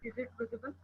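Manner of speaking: speaking very softly; barely audible, low volume; quiet voice. Real, not acted. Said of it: "Your voice is very low".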